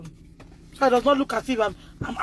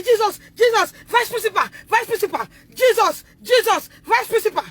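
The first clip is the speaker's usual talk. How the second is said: panicked cry; 7 semitones higher than usual. Retold or imitated. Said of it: "The woman said".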